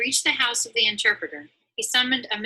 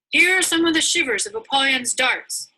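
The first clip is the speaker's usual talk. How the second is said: higher, louder guide's call; proud guide; 6 semitones higher, 6 dB louder than usual. Acted. Greatheart calls Christiana to see Apollyon's darts, proud and loud. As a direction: show off the relics with pride. Greatheart showing battle relics.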